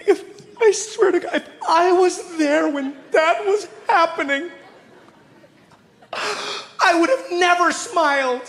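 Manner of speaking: high, slow, quavering mock sob. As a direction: play a teary liberal lady, overdoing it. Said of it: "she's like".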